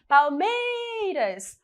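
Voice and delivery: "Palmeiras" with MEI held long and high; stressed syllable. Shown as a demonstration. Teacher showing the tonic syllable, "Palmeiras" stretched on MEI. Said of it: "é a sílaba mais forte".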